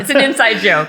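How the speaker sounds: ordinary talk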